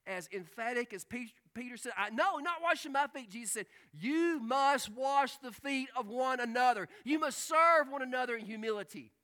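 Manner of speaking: loud and high, slowing on the command; forceful preaching. Acted. Preacher voicing Jesus' command to wash feet, loud. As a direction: make it a command.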